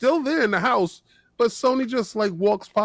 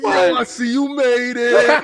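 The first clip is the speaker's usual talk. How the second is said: higher, louder mock greeting; mocking impression; 5 semitones higher, 6 dB louder than usual. She greets the lesser character in her game, mocking impression. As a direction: tease like she's greeting a nobody, pitched up. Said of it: "Why you gotta say it like that?".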